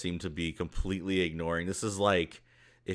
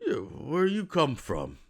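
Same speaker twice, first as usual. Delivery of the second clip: higher-voiced questioning ogre; puzzled ogre; 8 semitones higher than usual. Meditating ogre questions the intruder, in a higher character voice. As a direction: voice an ogre asking a stranger, pitched up. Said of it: "shakes his head and goes".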